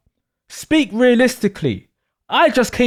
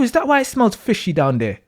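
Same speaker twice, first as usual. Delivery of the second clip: usual speaking voice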